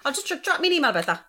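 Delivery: bright bluff to a caller who cannot be understood; bluffing on the phone. Retold or imitated. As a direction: pretend to follow along, brightly. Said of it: "And I'm like".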